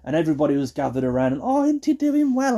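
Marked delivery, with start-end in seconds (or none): 1.4-2.6 very high, doting coo; cooing relative. Retold or imitated. Relatives gathered round a baby eating, cooing. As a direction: gush over a baby in a high voice.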